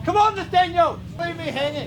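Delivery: loud, high shout to a driver; calling out. Real, not acted. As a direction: call him over from afar.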